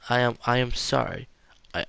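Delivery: plain reading voice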